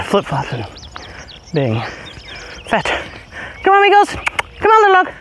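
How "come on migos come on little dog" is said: high dog calls. Real, not acted. Owner calling dogs back up the hill, very high call. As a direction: sing out to the dogs to come.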